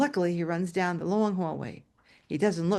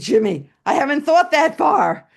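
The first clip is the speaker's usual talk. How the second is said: flustered exclamation; 9 semitones higher, 7 dB louder than usual. Retold or imitated. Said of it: "I said".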